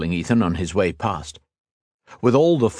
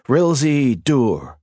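slow, higher chanted spell words; slow spell incantation; 6 semitones higher, 3 dB louder, much slower than usual. Acted. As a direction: intone the magic words slowly. Casting a spell.

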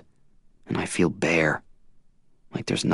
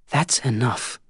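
put-on higher young woman's voice, reproachful; a hint of Tris accusing him; Tris, hurt; 6 semitones higher, slower than usual.

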